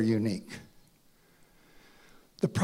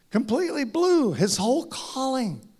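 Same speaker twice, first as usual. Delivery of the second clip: normal talking voice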